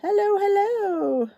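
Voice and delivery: high, slow singsong hello; greeting a viewer who just joined, singsong and slow. Real, not acted. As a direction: sing out the welcome to a newcomer. Greets new viewers joining stream.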